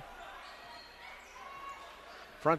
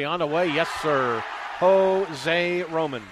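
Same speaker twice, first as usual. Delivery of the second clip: louder, drawn-out call of the scorer's name; stretching out the player's name in real excitement; excited score call; 5 dB louder, slower than usual. Real, not acted. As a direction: sell the big three like the crowd's on its feet. Three-pointer goes in, live call.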